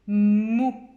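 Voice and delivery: loud "Mou" with a long initial m; stretched first sound. Shown as a demonstration. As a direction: draw out the m of "Mou" as a clue. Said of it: "quel est le son que l'on entend en premier". (« Mou » fort, m initial très allongé)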